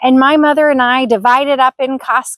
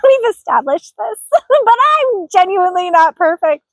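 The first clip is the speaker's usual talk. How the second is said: laughing through tears; 5 semitones higher than usual. Real, not acted. Laughing through tears, self-deprecating.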